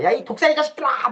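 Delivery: high-pitched imitation of an angry Jesus; angry rebuke. Lecturer acts Jesus cursing the rulers, angry high-pitched rebuke. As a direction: hurl the insult in mock fury. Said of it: "뭐 화내잖아".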